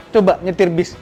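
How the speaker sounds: normal talking voice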